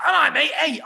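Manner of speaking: high, loud matey greeting; chirpy laddish hello; imitating Will. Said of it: "turned to me and said".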